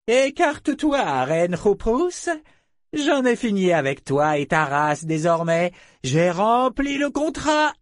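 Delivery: very high, slow, imperious voice; haughty dragon. A narrator's reading. Dragon finished with the human race, haughty dismissal. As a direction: dismiss them grandly, stretching every phrase.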